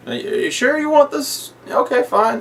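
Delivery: squeaky high polite German voice; high mock voice. Retelling the dentist's calm threat, high mock voice. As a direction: play the dentist prim and high.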